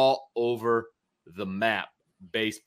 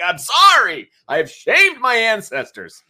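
high, loud, hammed-up shame; mock dramatic apology; 12 semitones higher, 10 dB louder than usual. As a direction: overplay the apology to the waiter. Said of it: "I'm like".

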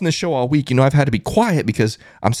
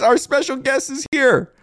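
ordinary delivery

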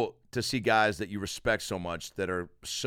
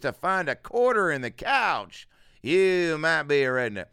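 higher, louder, slow Southern comic voice; Foxworthy parody; 6 semitones higher, 6 dB louder than usual. Retold or imitated. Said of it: "you can really add that to anything".

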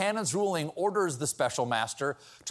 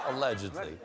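much lower and softer, ironic aside; deep mock hedge; 11 semitones lower, 6 dB quieter than usual. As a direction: drop the voice low for the hedge. Second hedge, deep and soft.

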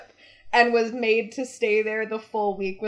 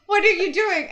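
imitating a crying child, pitched up; a higher, mock-distressed question; mock tearful; 7 semitones higher than usual. Crying sister watching mom pack her suitcase, mock upset. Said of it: "Nadia's there crying, and she's like".

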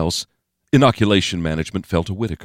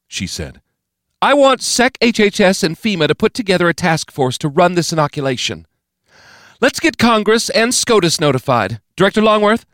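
higher, louder, authoritative declaration; commanding President; 14 semitones higher, 5 dB louder than usual. A narrator's reading. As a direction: declare it for the whole room.

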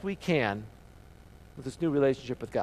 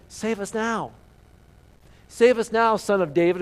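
louder, pleading cry of the crowd; crowd's plea; 4 semitones higher, 6 dB louder than usual. Retold or imitated. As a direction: call it out as the crowd begging. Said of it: "is what they were screaming".

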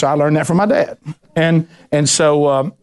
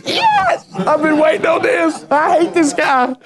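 high, shouted mock glee; a far higher crowd voice crowing finally; gleeful churchgoers; 14 semitones higher, slower than usual. People nudging each other, gleeful.

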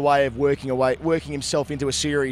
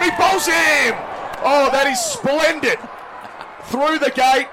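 excited wicket call; 12 semitones higher, 4 dB louder, slower than usual. Acted. Bowled, commentator erupts.

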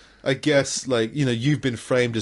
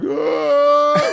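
imitated child wail; 13 semitones higher, 7 dB louder than usual. Kid upset at cancelled Halloween.

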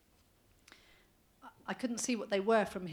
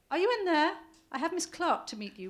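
raised voice calling through door; 9 semitones higher, 8 dB louder than usual. Acted. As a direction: call loudly into the room.

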